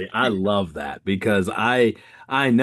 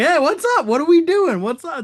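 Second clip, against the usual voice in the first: over-bright greeting; 6 dB louder than usual. Retold or imitated. Charming grumpy old man customer.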